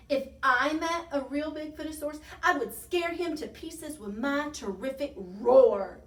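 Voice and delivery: loud, high-pitched bragging; bellowed boast. A narrator's reading. Tiny boasting to friends, loud bellow. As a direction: bellow it to top everyone. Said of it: "bellowed Tiny".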